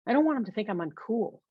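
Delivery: ordinary delivery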